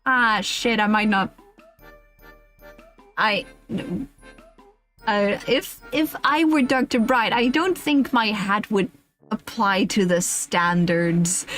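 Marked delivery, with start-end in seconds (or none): none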